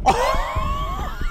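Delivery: high, wild laugh; burst of laughter. Real, not acted. Player cracking up at the crash, laughing. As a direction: crack up at the wreck.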